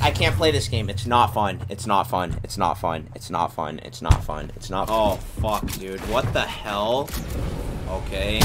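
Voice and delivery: low, soft and fading repeated muttering; defeated muttering. Real, not acted.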